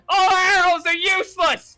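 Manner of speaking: high, loud despairing shout; desperate elf. Acted. Elf whose arrows bounce off, desperate shout. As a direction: cry out in panic. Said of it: "As the elf goes".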